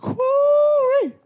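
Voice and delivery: high, loud joyful whoop; sudden delighted holler; excited whoop. Real, not acted. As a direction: cheer like the season just started. Football season excitement cheer.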